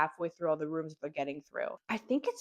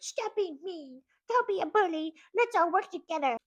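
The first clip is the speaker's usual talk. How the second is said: put-on much higher, sing-song kid voice; mock goody-goody kids; 12 semitones higher than usual. Older kids lecturing the bullies, high and preachy. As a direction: chirp it like a preachy do-gooder kid.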